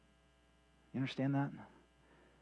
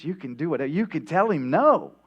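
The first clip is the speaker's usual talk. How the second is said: rising, louder plea; urging Peter; 5 semitones higher, 9 dB louder than usual. Retold or imitated. Peter urging Jesus to say no, building louder. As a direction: press Jesus to refuse.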